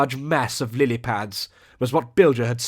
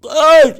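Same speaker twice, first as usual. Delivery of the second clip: very high, loud seal shriek; alarmed cry; 14 dB louder than usual. Acted. Seal sees the monster strike, very high loud shriek. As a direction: shriek a warning in terror. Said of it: "cried Seal Milton".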